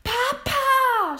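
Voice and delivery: very high, loud anguished scream. A narrator's reading. William sees his father shot, screaming. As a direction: scream it out high and long, falling at the end. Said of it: "schrie William".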